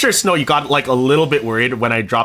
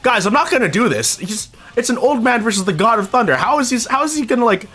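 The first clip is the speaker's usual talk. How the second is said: Thor; 9 semitones higher than usual. Retold or imitated. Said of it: "'Cause Thor's like".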